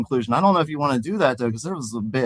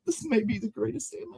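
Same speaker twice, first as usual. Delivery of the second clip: softer crybaby voice; 8 semitones higher, 9 dB quieter than usual. Acted. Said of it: "excitedly exclaimed Pete".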